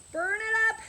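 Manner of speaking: high, loud push to burn; loud encouraging shout. Real, not acted. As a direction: shout to drive the class on. Coach pushing final set.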